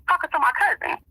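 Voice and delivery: ordinary talk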